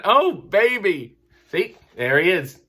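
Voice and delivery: ordinary talk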